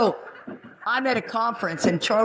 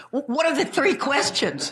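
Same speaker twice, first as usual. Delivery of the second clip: higher, stammering eager student; earnest workshop attendee, higher and breathless; eager dope; 7 semitones higher than usual. Retold or imitated. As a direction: play the clueless keen guy asking. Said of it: "this guy comes running up ... and goes".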